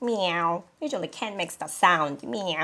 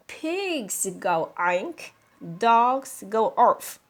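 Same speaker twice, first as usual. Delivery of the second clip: slow, deliberate explaining; patient teacher spelling it out; slow teaching; 3 semitones higher, slower than usual. Acted. Mother teaching slowly.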